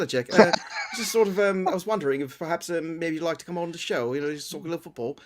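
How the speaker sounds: put-on a bumbling, polite request in an English accent. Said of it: "that's a very good accent".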